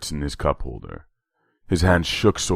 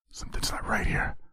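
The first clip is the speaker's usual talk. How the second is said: whispered inner warning; a quiet voice of caution in his head; 9 dB quieter than usual. A narrator's reading. Inner voice warning Terry about the cop: whispered. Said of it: "a voice cautioned in the quiet".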